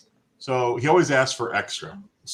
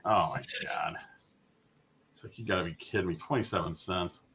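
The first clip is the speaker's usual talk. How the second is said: muttered exasperation; 3 semitones lower, 8 dB quieter than usual. Retold or imitated. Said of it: "I'm like".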